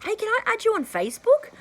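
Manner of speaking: high friendly ask to a new acquaintance; cheery request. Retold or imitated. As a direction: ask warmly with a hopeful lift. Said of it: "I'll say".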